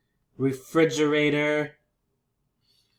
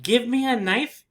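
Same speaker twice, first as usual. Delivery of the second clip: blunt rude demand; 9 semitones higher, 5 dB louder than usual. Shown as a demonstration. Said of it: "is a little bit rude".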